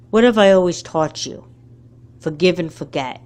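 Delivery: usual speaking voice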